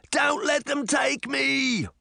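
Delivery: high, drawn-out plea; desperate cry. A narrator's reading. Jack pleading not to be taken by giants, crying out. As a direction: beg in terror, stretching the words. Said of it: "Jack cried".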